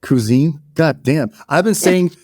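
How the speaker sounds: usual speaking voice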